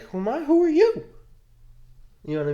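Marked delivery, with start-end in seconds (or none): 0.0-1.0 [name] retort. Retold or imitated. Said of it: "And [name] is like".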